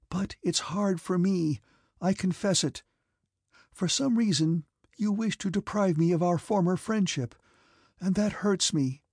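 high-pitched, wounded confession; tears in voice. A narrator's reading. Princess losing a former friendship, tears in her voice. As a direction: confess the hurt, close to tears. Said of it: "There were tears in her eyes and in her voice".

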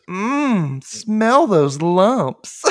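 high, very slow savoring voice; girl voice. Retold or imitated. Girl in the ad savoring the cereal, high and slow. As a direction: relish every word like a sweet ad kid. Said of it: "She goes".

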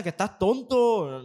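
retold yell. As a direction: shout at him like the angry girlfriend. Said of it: "se puso a gritarle".

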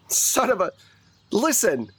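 high-pitched, exasperated interrogator; frustrated. Acted. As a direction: break off a curse and demand attention. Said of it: "he gets frustrated".

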